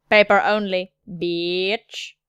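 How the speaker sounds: steady, level robotic delivery; measured machine monotone; android voice. Acted. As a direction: recite it like an android, pitch held steady. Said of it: "Something about the android voice".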